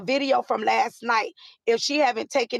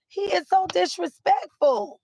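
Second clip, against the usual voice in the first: higher, drawn-out disapproval; appalled reaction; 6 semitones higher than usual. Retold or imitated. Woman retelling her reaction to his wife just sitting there, appalled. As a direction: retell your disapproval slowly, pitched up.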